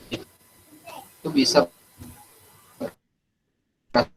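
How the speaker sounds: choppy, dropping-out audio; broken connection sound; radio breaking up. Real, not acted. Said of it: "Pak, suaranya putus-putus".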